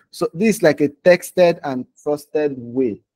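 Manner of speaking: ordinary talk, nothing marked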